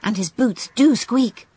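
put-on a young girl hoping for news; eager little girl. Gerda character speaks.

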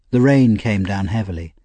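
ordinary delivery

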